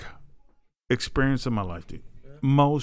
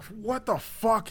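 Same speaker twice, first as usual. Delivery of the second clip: retold shock; 8 semitones higher, 6 dB quieter, much slower than usual. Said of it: "and I'm like".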